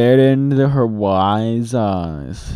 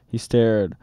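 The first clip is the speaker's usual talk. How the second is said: plain reading voice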